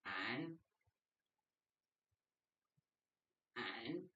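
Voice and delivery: soft, low breathy push sound; low exhale sound. Shown as a demonstration. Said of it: "This push is straight to the front".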